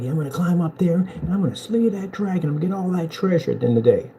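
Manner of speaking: lightly voiced tavern braggart; hyped-up boaster. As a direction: pump yourself up like a bragging knight. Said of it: "hyping yourself up like".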